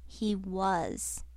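softly, dropping the volume right down; hushed, understated reply; very quiet. A narrator's reading. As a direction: say it very quietly, with weight. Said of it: "and said very quietly".

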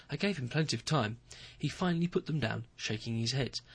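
ordinary delivery

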